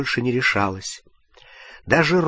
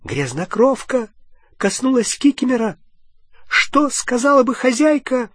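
high elf voice, full of loathing; appalled squeaky elf; disgusted house-elf; 12 semitones higher than usual. A narrator's reading. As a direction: recoil in disgust. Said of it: "с нескрываемым отвращением отпрыгнул".

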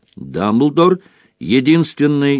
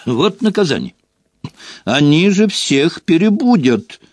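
higher, rising exclamation of annoyance; indignant, getting louder; fussing crossly over the racket; exasperated matron; 8 semitones higher, 4 dB louder than usual. A narrator's reading. Matron exasperated by noisy visitors.

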